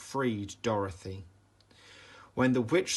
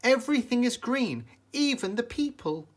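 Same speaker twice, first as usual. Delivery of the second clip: high, louder amazed voice; amazed girl; 12 semitones higher, 5 dB louder than usual. A narrator's reading. Dorothy marveling at the green city, high and loud. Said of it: "exclaimed Dorothy".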